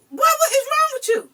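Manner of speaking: high, loud urgent demand; a mother's rising alarmed question. Retold or imitated. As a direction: press the child for an answer, high and loud. Mother demanding answer from child.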